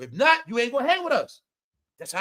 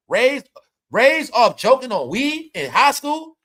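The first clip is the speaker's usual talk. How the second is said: loud, slow emphasis on raised; emphatic; 3 semitones higher, 7 dB louder, slower than usual. Real, not acted. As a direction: hammer home each word slowly. Emphatic repeat, slowed and loud.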